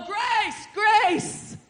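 high, drawn-out cry; declaring shout. Acted. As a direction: call it out high as you pass. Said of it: "that car lot and go".

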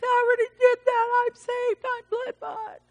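very high, squeaky-pitched mimicry; put-on high voice of a defensive churchgoer; mock protest. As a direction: whine it way up high in mockery.

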